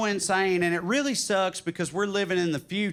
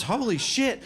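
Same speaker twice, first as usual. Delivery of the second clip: put-on higher-pitched eager kid; excited, pitched-up questions; amazed younger self; 6 semitones higher than usual. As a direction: bombard the time traveler with wonder. Amazed twelve-year-old self replies.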